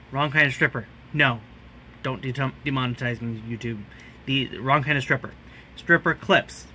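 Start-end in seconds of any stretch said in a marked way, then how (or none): none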